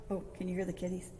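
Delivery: ordinary delivery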